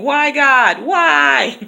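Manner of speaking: hammed-up cry to the heavens, pitch jumping up; mock anguished wail. Mock wailing at God over the end of the break. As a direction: melodramatic despair, half joking.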